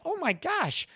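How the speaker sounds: high, light retold "wow" moment; amazed realisation. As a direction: marvel lightly at a sudden discovery. Retold amazed realisation at dog training.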